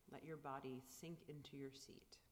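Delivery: breathy whisper; soft spa whisper. Acted. Whispered meditation instruction.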